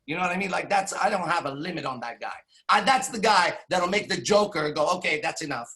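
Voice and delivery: ordinary delivery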